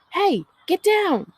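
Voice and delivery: high, loud call up to the roof; shout. A narrator's reading. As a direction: yell it sharply up at her. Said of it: "The shout makes Tally wobble".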